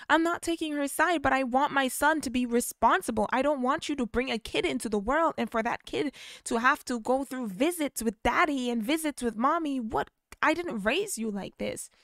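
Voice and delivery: high, loud arguing mother; arguing mom. Retold or imitated. Mom insisting to her son, high and loud. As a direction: push back firmly as mom.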